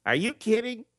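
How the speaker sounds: high, incredulous question; imitated disbelief. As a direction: pitch it way up as mock astonishment. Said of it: "He goes".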